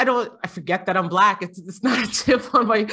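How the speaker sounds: ordinary talk, nothing marked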